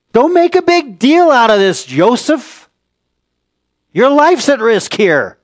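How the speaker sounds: high, loud, urgent plea rising to a shout; pleading interrogator. Retold or imitated. Scared interrogator urges Joseph to compromise, loud and pleading. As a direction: urge the prisoner to save his life, almost yelling. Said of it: "The interrogator, who was acting very scared".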